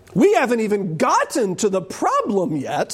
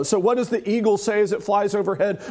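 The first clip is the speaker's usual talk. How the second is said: ordinary talk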